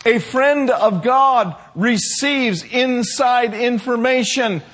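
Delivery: high, forceful exhortation building to a loud peak; impassioned preaching. Real, not acted. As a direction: urge the congregation with full conviction.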